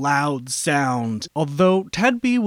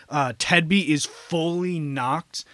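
ordinary talk, nothing marked

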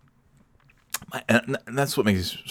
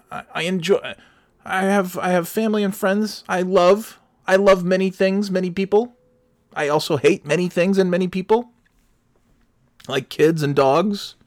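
higher, sweet mock voice of a cheerful sign person; mocking sign-lover voice; 10 semitones higher than usual. Mocking the live-laugh-love crowd in a high sugary voice. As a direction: lay on the fake wholesome cheer.

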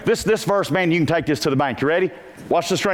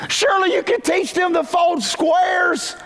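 exasperated; 13 semitones higher, slower than usual. Real, not acted. Preacher scolds parents who can't teach folding. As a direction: blurt it out in disbelief.

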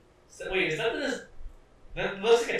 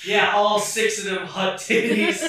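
louder, gleeful joke; gleeful emphasis; 4 semitones higher, 6 dB louder than usual. Real, not acted. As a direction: push it out with glee. Jokey emphatic.

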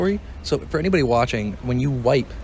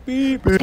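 censor bleep; 13 semitones higher than usual. Shown as a demonstration. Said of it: "no laughing, like".

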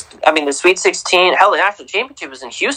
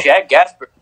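ordinary talk, nothing marked

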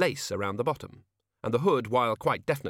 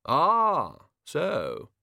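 a hint of a pondering Arch-Chancellor; lightly voiced musing old wizard; thoughtful; 6 semitones higher, 4 dB louder than usual. A narrator's reading. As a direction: draw it out as if weighing it. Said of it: "said the Arch-Chancellor thoughtfully".

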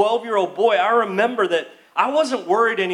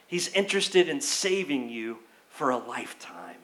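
breathy hushed whisper, lower and much softer; 7 semitones lower, 6 dB quieter than usual. Shown as a demonstration. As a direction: lean in and whisper it like a secret. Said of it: "Did you hear that?".

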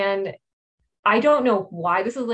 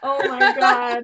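amused disbelief; 5 dB louder, slower than usual. Real, not acted. Reacting to juggalo joke.

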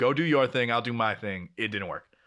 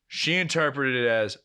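usual speaking voice

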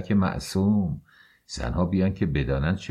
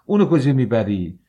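loud, higher shout at him; angry shout; 5 semitones higher, 6 dB louder than usual. Acted. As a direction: yell it out in alarm. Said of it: "فریادش بلند شد". (فریاد بلند و عصبانی)

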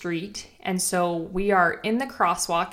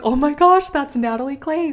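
high, loud gasp of recognition; excited fangirling; 7 semitones higher, 6 dB louder than usual. Retold or imitated. Fan spotting a coach through the window, excited fangirling. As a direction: re-enact spotting your idol, voice leaping up. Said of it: "again, I'm just fangirling".

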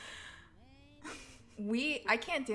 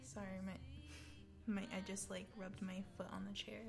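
soft and breathy, barely voiced, trailing into a whisper; embarrassed whisper; 9 dB quieter than usual. Real, not acted. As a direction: murmur it sheepishly under your breath. Embarrassed aside after a noise.

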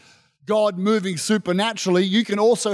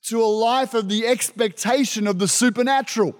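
ordinary talk, nothing marked

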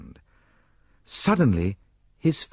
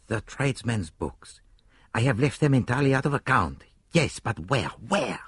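put-on accented detective thinking aloud, then higher and decisive; preoccupied Poirot; 4 semitones higher than usual. A narrator's reading. Poirot ignores Hastings, thinking aloud, then urgently decisive. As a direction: puzzle it out, then resolve firmly.

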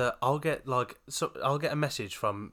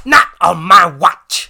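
dramatic shout; 4 semitones higher, 17 dB louder, slower than usual. Pretend. Playful dramatic outburst.

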